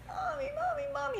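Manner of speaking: put-on high, nagging child's Mommy; whining child. Child whining at mommy for candy, high and nagging. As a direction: nag for mommy like a kid in a store.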